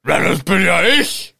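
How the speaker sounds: hammed-up singing giant; mock giant song. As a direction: belt it like a goofy cartoon giant. Giant introduces himself sung.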